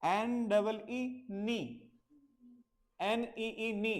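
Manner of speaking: very slow, letter by letter; teacher slowly spelling N double E. Shown as a demonstration. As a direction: spell it out carefully for learners. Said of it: "ऐसे देखो कि के है ही नहीं है".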